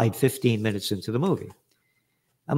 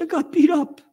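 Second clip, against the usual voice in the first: put-on much higher, louder kid voice; little kid complaining; 5 dB louder than usual. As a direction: squeak it out like a beaten six-year-old. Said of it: "I said".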